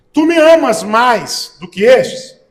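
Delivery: "amas mais" slow and loud; stressed question. Shown as a demonstration. Preacher shows the tônica of Jesus' question, stressed question. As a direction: hammer "amas mais" slowly, then ease off. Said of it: "Olha a tônica da pergunta".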